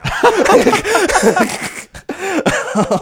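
long high laughing fit; real laughter. Real, not acted. Host cracking up at confusing plot, laughing. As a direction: laugh helplessly.

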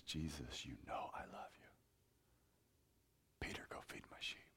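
whispered reply. Retold or imitated. Peter and Jesus on the shore, whispered. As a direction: breathe it out, barely voiced.

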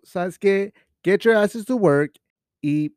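iron-fisted boss. Retold or imitated. Leader telling the gang to get working, bossy shout. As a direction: order them to work, loud and high. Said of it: "rule with an iron fist".

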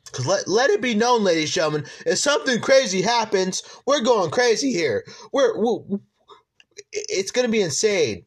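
loud, high hype-man energy; hype announcer. Real, not acted. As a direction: pump up the crowd, ladies and gentlemen. Podcast host hyping the fights.

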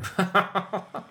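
giggling out of character; a burst of giggles mid-bit; breaking character giggling. Real, not acted. Guest laughs at Alejandro joke.

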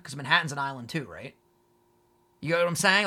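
ordinary talk, nothing marked

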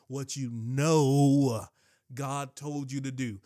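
"know" stretched long and loud, very slow; "what you know" dragged out with weight; stress on know. Real, not acted. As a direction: lean hard on "know". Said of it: "let me put the emphasis on this".

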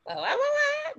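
high, rapid baffled whats. Retold or imitated. Mock baffled cries at a strange baby name. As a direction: sputter in disbelief at the spelling. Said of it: "I'm just like".

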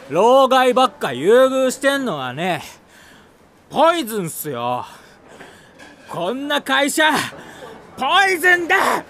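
slurred rant building to a shout; drunken shout. Acted.

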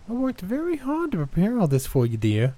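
high, forced cheery granny; strange cheeriness. A narrator's reading. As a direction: brittle sweetness over anger. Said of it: "with strange cheeriness".

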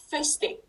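"Fusty" said alone and softly, as a model; pronunciation demo. Shown as a demonstration. Guest teaching the host a Scottish word, softly modelled "Fusty". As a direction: show how "Fusty" sounds in Scottish speech, softly. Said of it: "Is that how you pronounce it".

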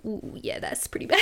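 breathy whispered wince. Retold or imitated. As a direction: hush it like a wince. Friend's hushed reaction.

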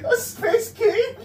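very high, laughing shriek; laughing squeal. Real, not acted.

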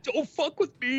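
high, sneering tough-guy quote; lightly voiced Will threatening; mock tough guy. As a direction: snap it like a cocky kid. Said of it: "He's like".

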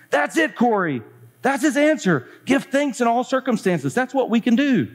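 high-pitched, excited realisation. Acted. Betsy tells Corrie it's his answer, high and excited.

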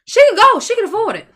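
high, loud exclaim; indignant outburst; outraged shout. Real, not acted. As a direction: blurt it out in disbelief. Outraged over cousin's lie.

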